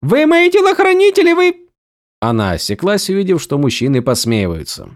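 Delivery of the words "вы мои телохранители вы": high, loud outraged protest; indignant shout. A narrator's reading. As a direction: demand they obey, loudly. Vivenna insists to her bodyguards. (возмущённый громкий крик)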